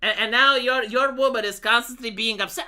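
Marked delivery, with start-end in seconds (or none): none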